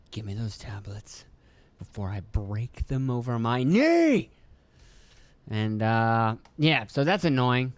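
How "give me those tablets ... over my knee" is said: imitating a fed-up dad laying down the law; mock parent threat. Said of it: "I was like".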